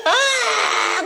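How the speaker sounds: high, loud mock wail; imitated childlike crying. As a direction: burst into tears like a child, acted out. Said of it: "갑자기 이렇게 우는 거예요".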